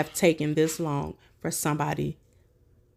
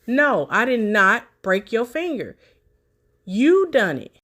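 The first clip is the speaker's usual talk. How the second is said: louder, higher insistent retort; mock-shouting the denial back at her; retold denial; 5 semitones higher, 6 dB louder than usual. Commentator voicing the man's denial, louder mock retort. Man denying breaking her finger.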